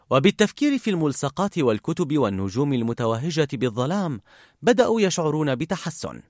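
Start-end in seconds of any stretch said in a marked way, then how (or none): none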